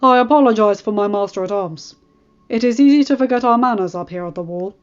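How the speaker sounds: lightly voiced lord commander. The Lord Commander apologizing and welcoming Robb, lightly voiced hearty host. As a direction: welcome them with gruff courtesy.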